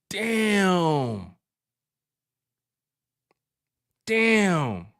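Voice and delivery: long, falling, drawn-out amazement; stunned drawl. Real, not acted. Host stunned by a viewer's payout, awed drawl. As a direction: let the word stretch and sink.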